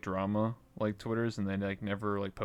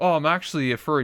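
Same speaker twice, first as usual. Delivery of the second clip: fast, louder, higher mock reveal; sudden reveal; 4 semitones higher, 6 dB louder, faster than usual. VR Chat guest revealing a twist, fast mock blurt. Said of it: "it'd just be like".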